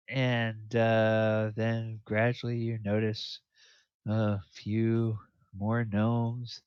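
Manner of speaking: ordinary talk, nothing marked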